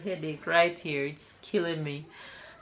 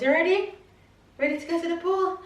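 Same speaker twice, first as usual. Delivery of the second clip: excited asking; 10 semitones higher than usual. Real, not acted.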